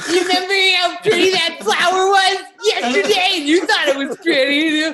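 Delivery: high, loud put-on voice breaking into laughter; mock gushing. Tripper reminding the quarterback about the flower, mock gushing. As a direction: gush it in a silly squeal, laughing.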